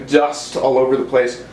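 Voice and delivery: real cough, throat full of dust. Real, not acted. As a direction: cough from dusty air. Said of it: "I'm just choking on dust".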